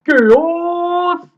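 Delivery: big booming reveal shout; dramatic shout. Acted. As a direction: call the name out like a trailer. Shouting a villain's name.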